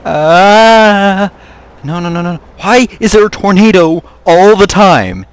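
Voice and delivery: loud, high cry of dismay; alarmed protest. Real, not acted. Player facing yet another tornado, loud alarmed complaint.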